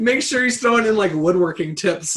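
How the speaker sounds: high and loud, giddy; excited laughing. Real, not acted. Co-host joking about woodworking tips, giddy. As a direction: pitch it up, still laughing.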